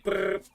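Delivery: high, buzzy lip trill; playful brrr. Pretend. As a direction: trill the lips like a motor. Gamer makes a playful noise.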